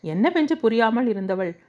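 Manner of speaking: ordinary delivery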